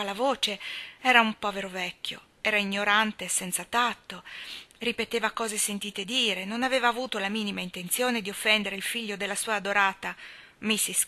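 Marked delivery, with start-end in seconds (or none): none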